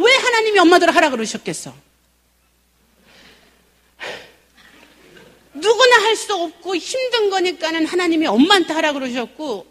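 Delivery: a hint of a teen daughter reassuring; daughter's voice. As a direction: encourage mom earnestly. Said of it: "둘째가 그래요".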